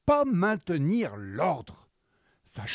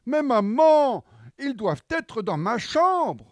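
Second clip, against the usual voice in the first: put-on little girl whining; 6 semitones higher, 5 dB louder than usual. Juliette protests.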